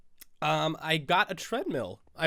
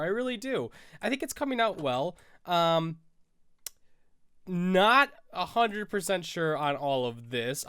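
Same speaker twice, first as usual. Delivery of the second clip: normal talking voice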